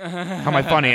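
loud, high mock-threatening demand; mock menacing Joe Pesci. Gangster to the man who called him funny, loud mock menace. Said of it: "And Joe Pesci goes".

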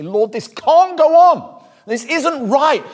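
loud, high, slow plea; crying out to God. Acted. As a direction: cry out to the Lord in anguish. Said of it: "You cry for the situation".

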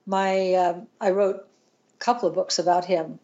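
usual speaking voice

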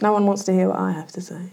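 whispered self-pitying moan, playing the victim; mock self-pity. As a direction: breathe it out like a hard-done-by martyr. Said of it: "Playing victim".

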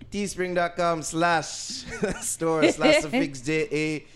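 loud, laughing hype pitch; hyped merch plug. Acted. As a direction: pump up the merch like a hype man.

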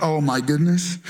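low, weary groaning words; dismayed kid. Acted. Kids hearing dad's rules from pastor, dismayed. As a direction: drop the voice, fed up. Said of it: "Some of the kids are sitting there".

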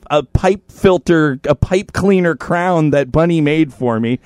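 ordinary talk

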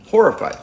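horrified said high and loud; emphatic echo; stressed repeat. Real, not acted. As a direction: punch the word horrified. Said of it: "she's, like, horrified".

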